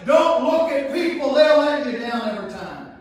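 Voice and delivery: full-force sermon shout; shouted preaching. Acted. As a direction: shout it out to the congregation.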